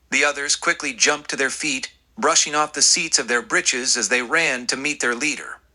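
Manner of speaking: usual speaking voice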